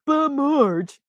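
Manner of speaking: high cartoon whine; Homer Simpson voice. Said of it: "in The Simpsons".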